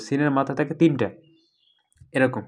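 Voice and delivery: normal talking voice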